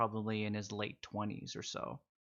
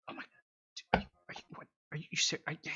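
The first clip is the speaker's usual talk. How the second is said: stunned whisper; 5 semitones higher, much faster than usual. Acted. Stunned soldier voiced unvoiced.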